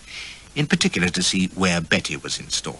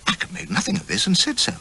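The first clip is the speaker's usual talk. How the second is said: usual speaking voice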